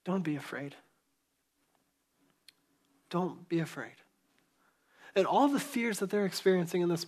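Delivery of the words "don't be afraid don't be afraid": tender reassurance. Retold or imitated. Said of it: "and he says".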